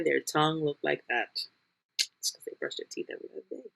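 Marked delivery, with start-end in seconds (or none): 2.2-3.7 fast whispered aside; whispered joke. Real, not acted. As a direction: whisper it like a secret dig.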